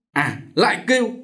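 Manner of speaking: high-pitched menacing outburst; angry threat. A narrator's reading. Chồng tức giận dọa vợ. (giận dữ đe nẹt)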